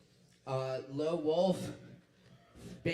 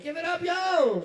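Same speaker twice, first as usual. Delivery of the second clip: hype shout; 11 dB louder than usual. Real, not acted. Host hyping crowd for performer.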